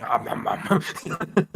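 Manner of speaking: slow munching om nom sound; munching noises. Retold or imitated. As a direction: voice a creature chomping happily. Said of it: "Legu's friend is just like".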